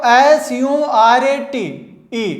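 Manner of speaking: letters spelled out slowly; slow spelling. Shown as a demonstration. Teacher spelling the word slowly for students. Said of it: "spelling letters".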